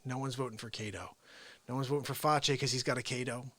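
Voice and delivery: normal talking voice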